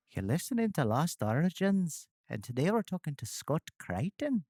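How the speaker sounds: high-pitched attempt at a Scottish accent; best Scottish accent. Host takes the accent challenge for the guest, mock Scottish accent. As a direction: put on your best lilting Scottish, swooping high. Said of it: "in our best Scottish accent".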